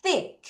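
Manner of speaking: "thick" with tongue pushed out and pulled back; high-pitched model of "thick" with a clear TH; TH pronunciation demo. Shown as a demonstration. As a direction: show learners the TH in "thick". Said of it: "혀 넣다 빼세요".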